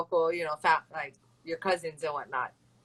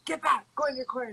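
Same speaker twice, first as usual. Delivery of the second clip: quick referee order; 6 semitones higher, faster than usual. Retold or imitated. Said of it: "they're like".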